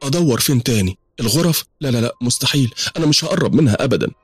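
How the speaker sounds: high-pitched fretting to herself; acted weary, searching questions; tired self-talk. A narrator's reading. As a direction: think aloud, wearily stumped. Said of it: "وهي تعبانة وبتقول لنفسها".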